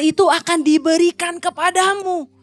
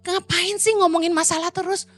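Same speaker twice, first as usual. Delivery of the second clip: usual speaking voice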